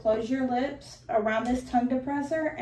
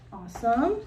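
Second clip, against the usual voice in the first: ordinary talk, nothing marked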